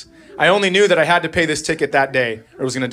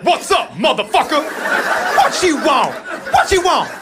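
mock tough guy; 11 semitones higher than usual. Imagined phone answerer, hammed-up street greeting. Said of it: "she was like".

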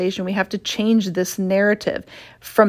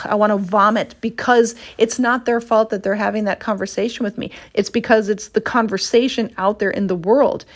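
ordinary delivery

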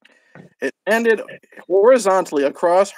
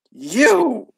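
growled; 7 semitones higher than usual. Acted. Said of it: "he growled".

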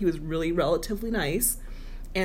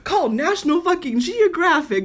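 much higher, louder hammed-up proclamation; teasing self-mockery, loud and high; mock self-announcement; 11 semitones higher, 7 dB louder, slower than usual. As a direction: trumpet your own mistake as breaking news. Mocks herself like a broadcast.

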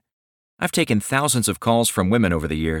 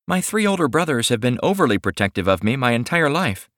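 higher, sweet shy woman's voice; shy young woman; 6 semitones higher than usual. Acted. Millie continues confiding.

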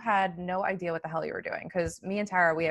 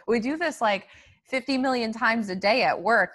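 usual speaking voice

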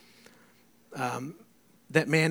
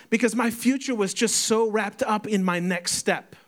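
preaching climax; 7 semitones higher, 5 dB louder than usual. Acted. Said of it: "preaching climax".

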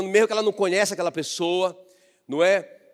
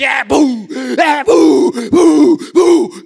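booming stomps; 9 semitones higher, 12 dB louder, much slower than usual. Acted. God arrives stomping, preacher's effect.

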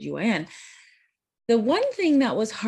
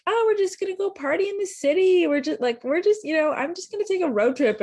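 high, fast carefree mock voice; mocking siblings; 13 semitones higher, 5 dB louder, faster than usual. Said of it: "They're like".

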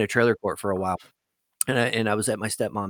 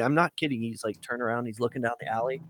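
ordinary delivery

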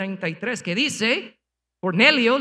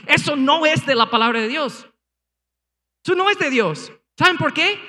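higher and louder, NO driven hard; forceful rebuke; 7 semitones higher, 5 dB louder than usual. Real, not acted. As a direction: preach it hard against the error. Preacher rebukes false teaching.

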